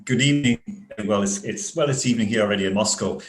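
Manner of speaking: ordinary talk, nothing marked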